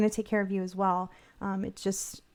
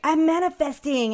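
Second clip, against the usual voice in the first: high, louder mock gushing; mock reality-show contestant; 7 semitones higher, 6 dB louder than usual. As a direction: ham up a starry-eyed TV contestant. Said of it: "this lady on Big Brother who used to be like".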